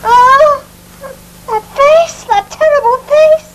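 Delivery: high-pitched horror, rising in alarm; terrified. Acted. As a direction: gasp out your horror at what you saw. Woman terrified by face at window.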